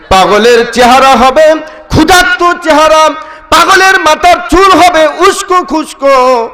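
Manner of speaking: high, ringing declamation; impassioned preaching. Acted. Meccans describe a madman to Abu Jahl, high impassioned declamation. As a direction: cry it out from the pulpit. Preacher acts the onlookers' protest.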